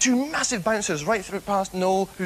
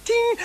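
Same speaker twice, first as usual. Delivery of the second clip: high sung lift chime; ping it like a lift bell; 3 dB louder than usual. Retold or imitated. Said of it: "And they went".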